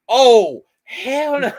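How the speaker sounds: loud, laughing "hell no" blurted out; loud refusal. Retold or imitated. The Marine blurting his refusal, loud and laughing. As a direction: burst out with it, half laughing.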